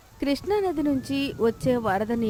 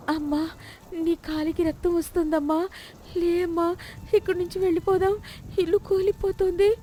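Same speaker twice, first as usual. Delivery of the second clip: put-on much higher, rushed frightened child voice; panicked child; 8 semitones higher than usual. Chinni seeing her mother's blood, panicked. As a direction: beg mother to flee, fast.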